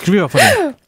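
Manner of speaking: high startled warning yell; sudden shrill warning. Real, not acted. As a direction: shout a warning in alarm. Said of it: "schrei mich nicht so an".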